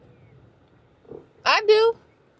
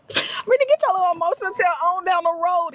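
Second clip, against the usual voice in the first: high, fast teasing nudge to herself; mock-stern pep talk; playful self-scolding; 9 semitones higher, faster than usual. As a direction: chide yourself like a sassy auntie. Scolding herself while driving.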